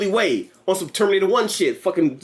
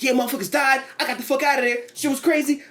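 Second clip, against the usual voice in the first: put-on kid voice; 6 semitones higher than usual.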